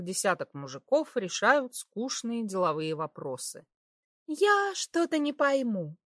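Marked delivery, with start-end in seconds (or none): 4.3-6.0 put-on high innocent girly voice; breathy cutesy giggly. A narrator's reading. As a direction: play it sweetly innocent. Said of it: "самым невинным видом сказала она". (невинный кокетливый голосок)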